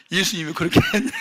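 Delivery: loud, high, quick rebuke with a laugh in it. Retold or imitated. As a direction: scold him, half laughing. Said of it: "막 그런 책망이 오는 거예요". (웃음 섞인 빠르고 큰 꾸짖음)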